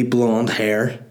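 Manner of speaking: ordinary delivery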